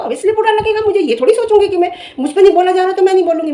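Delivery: high-pitched, heated protest; indignant outburst. Real, not acted. Vlogger vowing to speak up for others, indignant and high.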